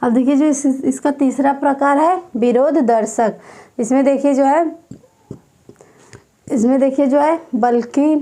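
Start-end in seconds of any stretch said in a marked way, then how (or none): none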